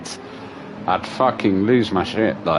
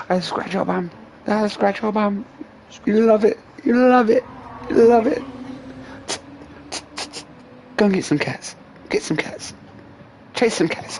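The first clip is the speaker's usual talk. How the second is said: excited high doggy voice; 12 semitones higher than usual. Real, not acted. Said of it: "playful baby talk to dog".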